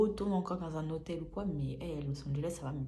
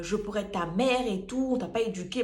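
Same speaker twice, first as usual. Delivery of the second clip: loud, higher mock scolding of a roommate; scolding like an offended mother figure; mock angry scolding; 5 semitones higher, 7 dB louder than usual. Roommate scolding her, mimicked loud and high.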